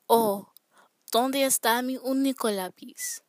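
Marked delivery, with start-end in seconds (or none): 1.1-3.2 "único" pressed, with a rise; stress on único. Shown as a demonstration. Teacher showing stress on the only pencil, pressing "único". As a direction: lean hard on "único". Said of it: "To explain the stress over this pencil".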